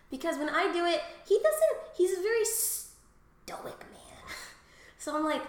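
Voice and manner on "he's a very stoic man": whispering. Real, not acted. Laughing, hushed comment.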